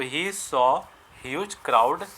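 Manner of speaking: each word spaced out, very slow; slow dictation. Shown as a demonstration. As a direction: dictate it slowly so students can write. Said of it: "slow dictation for students". (धीरे-धीरे लिखवाना)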